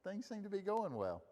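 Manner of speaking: a hint of a satisfied servant chatting; Pharaoh's court pleased. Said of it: "It's like".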